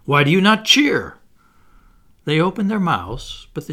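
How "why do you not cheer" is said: loud, high, indignant question; heated, forceful challenge; hot-tempered. A narrator's reading. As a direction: demand it hotly. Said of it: "he said hotly".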